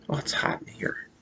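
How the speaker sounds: breathy whisper to herself; a quiet muttered complaint about the heat; whispered aside. Real, not acted.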